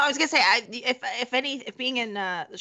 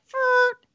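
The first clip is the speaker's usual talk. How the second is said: higher, louder "Furt" imitating a fart; mock fart noise; 13 semitones higher, 5 dB louder than usual. Guest imitates the host's fart joke with a high mock fart. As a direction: blurt out "Furt" like a fart sound. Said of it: "you're always like".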